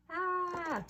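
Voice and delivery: sharp high gasp of shock. Acted. Reader gasping at the dragon's attack, shocked. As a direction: gasp as if you just saw it.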